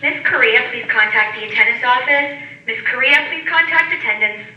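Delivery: ordinary talk, nothing marked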